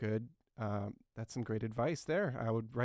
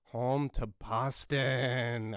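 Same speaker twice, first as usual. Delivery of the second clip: very slow, last word stretched long; stretched-out fanfare; drawn out; 3 dB louder, much slower than usual. Acted. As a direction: announce it slowly, holding the final syllable. Drawn-out hype for the city.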